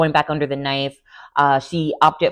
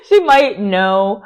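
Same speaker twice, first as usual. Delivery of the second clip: laughing emphasis; 3 semitones higher, 4 dB louder, slower than usual. Shown as a demonstration.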